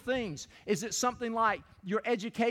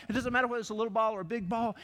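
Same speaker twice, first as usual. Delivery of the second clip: ordinary talk, nothing marked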